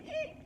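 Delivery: tiny shrill eek of worn brakes; high squeak imitation. Comic describing car brakes, squeaking eek.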